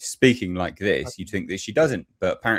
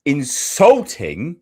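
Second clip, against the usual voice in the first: indignant, leaning hard on each word; real outrage, stretching the key word; outraged; 7 dB louder than usual. Real, not acted. Ranting at a debate clip.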